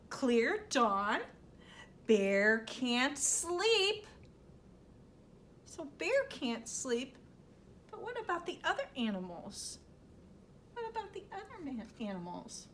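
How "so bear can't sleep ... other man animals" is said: soft fast hush. Acted. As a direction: murmur it quickly. Hushed aside to audience.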